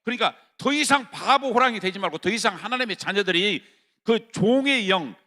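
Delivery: loud, high sermon cry; fervent shouted preaching; full-force preaching. Acted. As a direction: preach it hard to the congregation.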